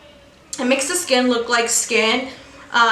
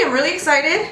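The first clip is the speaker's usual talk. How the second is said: ordinary delivery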